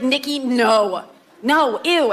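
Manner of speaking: slow, drawn-out grossed-out refusal; disgusted sister. Retold or imitated. Sister recoiling at lunch, slow disgusted no. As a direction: stretch the no like you smelled something bad. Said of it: "she was just like".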